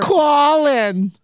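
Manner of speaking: high, drawn-out mother's call; Tanner's mom. Pretend. Co-host mimicking a crewmate's mom, high drawn-out call. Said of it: "doing impressions of Tanner's mom".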